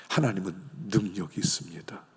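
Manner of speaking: soft unvoiced whisper; whispered faith. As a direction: whisper it as a secret truth. (속삭이듯)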